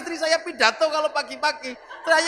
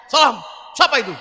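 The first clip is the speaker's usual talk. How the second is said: much louder, curt answer; gruff loud reply; 6 dB louder than usual. Retold or imitated. Umar in his grave answering the angels, loud curt reply. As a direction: snap the greeting back.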